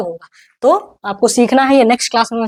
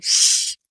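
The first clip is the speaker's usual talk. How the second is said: single voiceless "shh"; breath-only hushing sound; roommate's shush; 6 dB quieter, much slower than usual. Acted. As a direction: shush someone who is disturbing your study. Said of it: "ऐसे बोलेगी".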